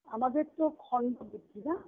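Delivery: normal talking voice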